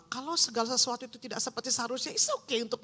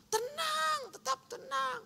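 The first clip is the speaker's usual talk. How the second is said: high shouted call to stay calm; 14 semitones higher, slower than usual. Real, not acted. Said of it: "preacher urging calm, shouted high". (teriakan tinggi tenang)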